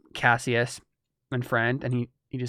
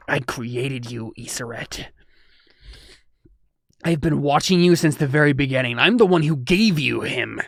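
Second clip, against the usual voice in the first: a menacing villain addressing his creation; villain's speech; 5 dB louder than usual. Acted. Villain speaks to hero.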